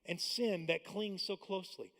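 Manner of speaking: measured, solemn reading of the verses; steady, reverent Bible reading; scripture reading. Acted. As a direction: read the passage like Sunday scripture.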